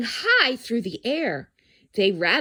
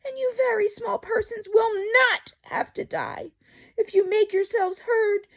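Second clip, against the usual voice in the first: calling out; 12 semitones higher than usual. Acted. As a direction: shout encouragement across the distance. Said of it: "he managed to call to the mayor".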